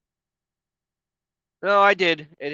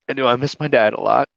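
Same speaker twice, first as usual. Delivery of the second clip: ordinary talk, nothing marked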